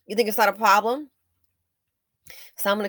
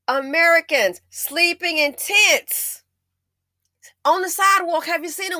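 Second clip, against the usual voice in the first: outraged; 10 semitones higher, 6 dB louder than usual. Real, not acted. Outraged about homeless Americans.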